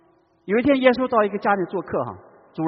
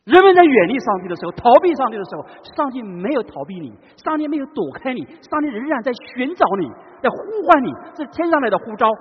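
impassioned preaching; 6 semitones higher, 7 dB louder than usual. Acted. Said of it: "preaching climax".

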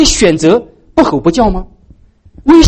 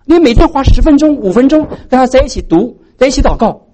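excited urging; 8 semitones higher than usual. Real, not acted. Preacher urging parents with enthusiasm.

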